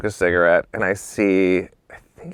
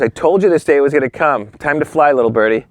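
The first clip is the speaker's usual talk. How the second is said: louder, higher voice of a boss; mock send-off; 6 semitones higher, 6 dB louder than usual. Boss pushing his assistant out of the nest, proclaiming loudly. Boss dismisses the narrator, retold.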